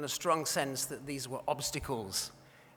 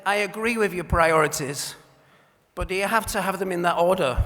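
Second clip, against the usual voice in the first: cheeky schoolboy retort; 4 semitones higher, 11 dB louder than usual. Retold or imitated. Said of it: "And he said".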